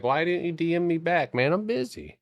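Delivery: high-pitched mocking back-and-forth; mock whining. Streamer mimicking people asking about unanswered DMs, high mocking voice. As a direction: act out a pestering follower and answer, voice pushed high. Acts out complainers and his reply.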